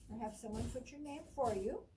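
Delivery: normal talking voice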